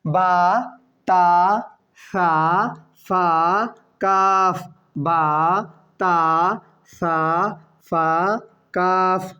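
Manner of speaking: each letter name sounded out slowly and clearly; drilled letter recitation. Shown as a demonstration. Teacher drilling students on the letter names. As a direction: recite the letters one by one for learners. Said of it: "তাহলে দেখুন".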